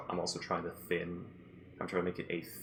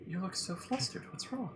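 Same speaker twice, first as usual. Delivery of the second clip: innocent high voice; 13 semitones higher than usual. Acted. Character teasing Orion.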